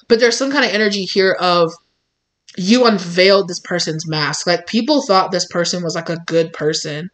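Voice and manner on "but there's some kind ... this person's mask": usual speaking voice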